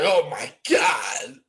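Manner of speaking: long, drawn-out mock wail; mock dismay. Friend reacting to his buddy's country talk, mock-horrified wail. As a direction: stretch each word in exaggerated horror.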